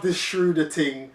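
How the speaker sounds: "Schroeder" said SHROO-duh, with an OO vowel; mocking quote of "Schroeder" as SHROO-duh; mispronunciation shown. Shown as a demonstration. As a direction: put an OO in "Schroeder". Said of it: "just pronounce the man's name correctly".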